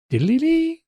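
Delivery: high playful jingle; sung jingle. Retold or imitated. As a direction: chirp it like a cute device. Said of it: "Just go".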